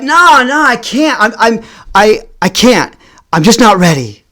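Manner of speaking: high protest sinking as it goes; mock-desperate, stammering refusal; flustered pleading. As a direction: beg off in halting bursts.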